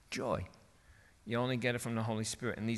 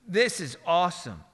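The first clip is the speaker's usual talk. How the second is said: ordinary delivery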